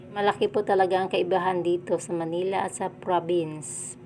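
ordinary talk, nothing marked

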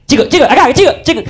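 mock desperate open-player call; calling for the ball. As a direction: beg teammates with a laugh.

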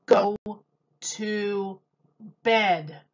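put-on a firm parent sending a child to bed; stern parent. Parent telling a stubborn child to go to bed, stern. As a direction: put your foot down, bedtime is bedtime.

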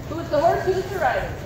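ordinary talk

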